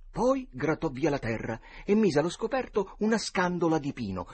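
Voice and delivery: usual speaking voice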